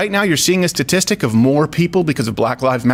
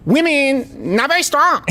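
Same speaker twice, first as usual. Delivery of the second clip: high, halting mock Chinese accent; caricatured Chinese officer laying down a verdict; broken English in a put-on Chinese accent; Chinese military leader impression; 9 semitones higher, slower than usual. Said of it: "doing an impression of a Chinese military leader".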